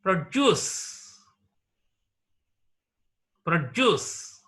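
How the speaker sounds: produce as a verb, pro-DUCE, end punched; second-syllable stress. Shown as a demonstration. Teacher modelling the verb produce, second syllable stressed. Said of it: "The stress is marked on the second syllable".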